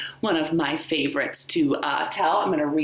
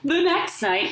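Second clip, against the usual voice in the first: ordinary delivery, nothing marked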